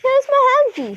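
high, loud cry of delight. A narrator's reading. Medal finding his lost hanky, delighted. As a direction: burst out gleefully, pitch leaping up. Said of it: "suddenly beamed with delight".

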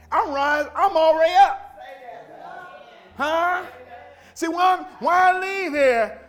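high-pitched, impassioned preacher; exultant pulpit cry; full-force preaching. Real, not acted. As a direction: preach it out high, drawing out the key words.

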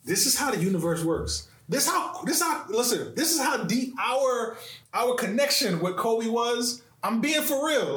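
high-pitched, eager and insistent; bursting to tell his own story; excited. Real, not acted.

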